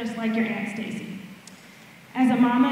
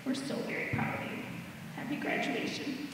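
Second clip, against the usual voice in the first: whispered, very soft and a little quick; tender whisper; 3 semitones higher, 13 dB quieter than usual. Real, not acted. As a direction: whisper it to her privately, as a proud mother. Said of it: "just as I whisper to you".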